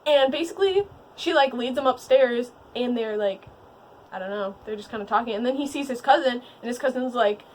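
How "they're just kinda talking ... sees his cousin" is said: usual speaking voice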